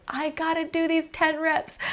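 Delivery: high, slow strained voice; mock gym struggle. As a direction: act out psyching yourself up for reps. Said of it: "we're just like".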